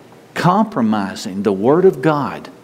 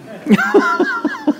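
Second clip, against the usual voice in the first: high burst of real laughter; a sudden delighted laugh, pitched high. Real, not acted. Pastor cracking up at God's quip, laughing high.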